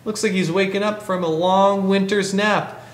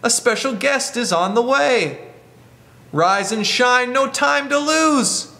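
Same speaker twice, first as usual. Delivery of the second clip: put-on higher, excited little boy voice; higher wake-up call from [name]; 5 semitones higher, slower than usual. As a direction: call the animals awake brightly. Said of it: "they say".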